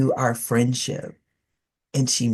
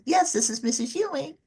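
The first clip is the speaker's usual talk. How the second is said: much higher, put-on phone manner; representative voice; 13 semitones higher, 3 dB quieter than usual. Grandmother introducing herself to a caller, high representative voice. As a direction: imitate grandmother's formal telephone voice, high. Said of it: "that's the representative talking".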